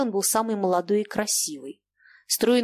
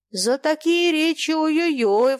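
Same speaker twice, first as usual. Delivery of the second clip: slow, high, drawn-out warning; imposing warning; 7 semitones higher, 4 dB louder than usual. A narrator's reading. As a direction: warn weightily, stretching it out. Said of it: "внушительно сказал Зубов". (внушительно, нараспев)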